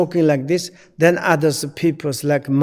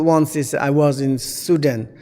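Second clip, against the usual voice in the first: ordinary delivery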